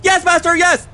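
hunchback assistant. Acted. Servile pupil answering drill master.

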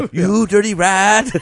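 a quick hammed-up Cagney line; James Cagney impression. Joking about his Cagney bit, slow gangster voice. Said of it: "my James Cagney impression".

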